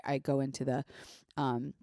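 ordinary delivery

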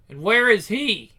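father grilling his daughter; stern demand. Acted. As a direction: demand the answer slowly. Father interrogates.

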